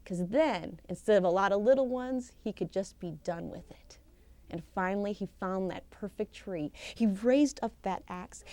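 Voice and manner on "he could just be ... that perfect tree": whisper. A narrator's reading. Whispered storytelling suspense.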